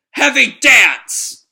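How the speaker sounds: slow, high, very loud battle cry; attack shout. Acted. Shiki slamming every enemy, shouted attack name. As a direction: bellow the move name, stretched out.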